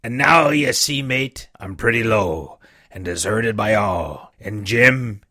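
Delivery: put-on a weak old seaman coaxing the boy; dying buccaneer begging the boy for rum; old sick sea captain. The old captain voiced in reading.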